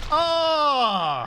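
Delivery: sudden high loud shriek of glee; excited scream. Real, not acted. Reacts to in-game success.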